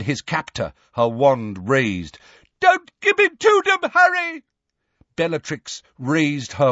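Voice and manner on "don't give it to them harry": frantic high-pitched roar; Neville. A narrator's reading. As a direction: yell a desperate plea to a friend, loud and high. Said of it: "roared Neville".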